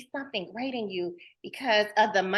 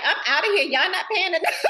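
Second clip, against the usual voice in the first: sassy retold quip; 8 semitones higher, 5 dB louder, faster than usual. Retold quitting line, laughing.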